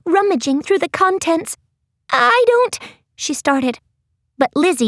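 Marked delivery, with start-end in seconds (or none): 2.1-2.8 high character voice; flustered Maddie. A narrator's reading. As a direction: start high, as if about to protest. Maddie begins to protest.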